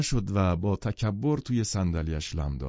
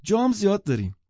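very high, louder woman's voice; eager, pitched-up persuasion; insisting; 12 semitones higher, 5 dB louder than usual. A narrator's reading. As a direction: press the invitation as truly meant. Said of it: "زن باز اصرار کرد".

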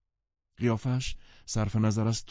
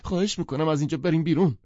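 fast, higher and louder pleading moan; moaning plea; 10 semitones higher, 6 dB louder, faster than usual. Acted. Daisy begs to leave, moaning fast and higher. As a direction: beg to leave in distress. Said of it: "دیزی نالید".

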